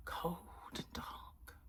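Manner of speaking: hushed, gloomy aside; whispered gloom. Real, not acted. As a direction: breathe it out like a shiver. Brooding on the cold night.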